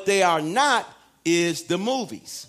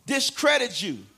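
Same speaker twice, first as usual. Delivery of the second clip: ordinary talk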